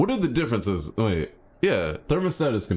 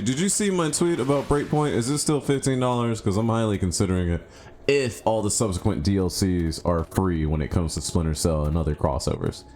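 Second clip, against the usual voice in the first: usual speaking voice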